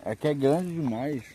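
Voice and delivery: slow, talking through a mouthful of food; mouth full. Real, not acted. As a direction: say it slowly around a bite of food. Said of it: "Falando de boca cheia".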